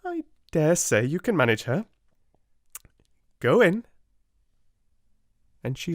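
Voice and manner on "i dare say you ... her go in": Miss Minchin brisk. A narrator's reading. Miss Minchin dismisses Sarah.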